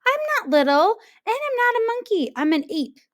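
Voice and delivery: put-on high, fast indignant child; indignant protest. A narrator's reading. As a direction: correct her hotly. Jim protests.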